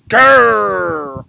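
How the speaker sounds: loud rolling growl; tiger growl. A narrator's reading. Fighting tigers growling, loud and fierce. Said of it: "a horrible noise that sounded like".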